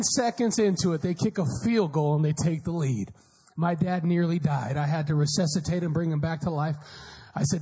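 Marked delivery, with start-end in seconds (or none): none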